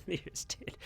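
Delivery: breathy mock-grave whisper, half joking; mock dramatic whisper. Teasing viewers with a fake death announcement, mock dramatic whisper. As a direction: whisper it like a hammed-up bad-news reveal. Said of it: "his voice got all low".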